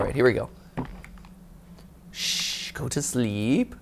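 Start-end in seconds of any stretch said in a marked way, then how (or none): 2.1-3.7 hushing. Pretend. Hushing a monster to sleep.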